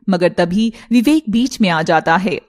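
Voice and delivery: plain reading voice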